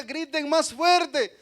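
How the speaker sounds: mocking Elijah-style shout; mocking taunt. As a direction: call it out to tease them. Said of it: "Elías se burlaba de ellos, les decía".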